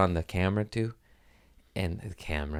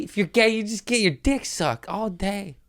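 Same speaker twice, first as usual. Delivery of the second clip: ordinary talk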